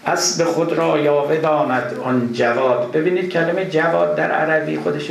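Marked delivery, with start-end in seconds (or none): 0.1-2.9 slow, louder, chanted reading of the line; verse recitation. Acted. Lecturer reciting the verse again to his class, slow chanted recitation. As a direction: intone the line slowly and fully.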